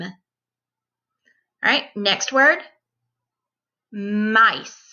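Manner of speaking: ordinary delivery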